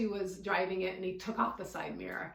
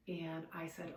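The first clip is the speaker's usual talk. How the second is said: usual speaking voice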